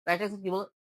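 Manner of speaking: ordinary delivery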